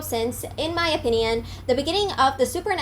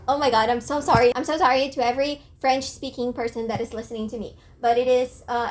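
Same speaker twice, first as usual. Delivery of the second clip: ordinary talk, nothing marked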